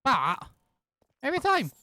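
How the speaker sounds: loud exasperated outburst; frustrated shout. Real, not acted. Frustrated after accidentally killing bats.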